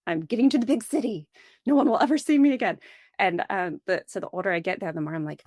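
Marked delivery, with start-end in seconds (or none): 0.1-2.8 youthful defiance. Retold or imitated. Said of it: "being like".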